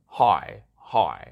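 "Hỏi" said alone with a dipping tone, clearly separated; hỏi tone demo. Shown as a demonstration. Host showing listeners how the tone sounds, "hỏi" with its dip. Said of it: "but it's like".

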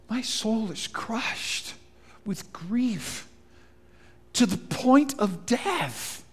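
slow, soft, crushed with grief; grieving. Acted. Jesus telling his friends his soul is crushed, slow and grieving. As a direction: let the grief weigh every word.

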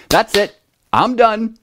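high-pitched imitation of a fed-up little girl; Rylan's voice. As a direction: declare you quit in a small child's high voice. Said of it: "Rylan just looked at all of us and said".

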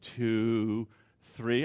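low, quiet count mid-argument; soft counting. Shown as a demonstration. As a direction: count softly to calm down. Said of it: "start counting".

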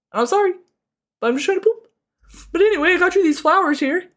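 whimpering out a sorry to a ghost, hammed-up; mock sobbing babble. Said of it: "cried a lot to a ghost".

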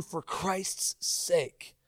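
slow, soft, drawn-out appeal; drawn-out plea. Acted. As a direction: stretch each word as a gentle plea. Preacher dwells on key phrase.

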